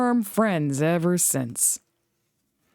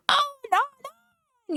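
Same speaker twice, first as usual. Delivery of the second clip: much higher alarmed cry; yelling; faster than usual. A narrator's reading. Trucks crying out as brakes bite, high alarm. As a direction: panic as brakes scream. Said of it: "yelled the trucks".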